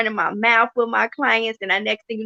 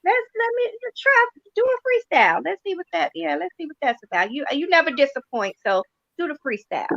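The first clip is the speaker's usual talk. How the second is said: much higher, a touch brighter client voice; pretend client; 7 semitones higher than usual. Pretend client agreeing to the freestyle, voiced higher. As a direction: play the client, high and eager. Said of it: "pretend like you're my client".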